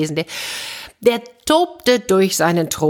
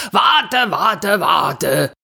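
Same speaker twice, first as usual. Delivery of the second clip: king calling out; 3 semitones higher, much slower than usual. A narrator's reading. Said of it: "rief der König".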